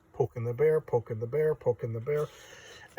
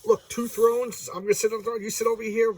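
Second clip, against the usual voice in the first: high, eager mock salesmanship; imitated giddy persuasion, pitched up; 9 semitones higher, 4 dB louder than usual. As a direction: act out an over-keen suitor showing off. Thanos pitches thrones to Death.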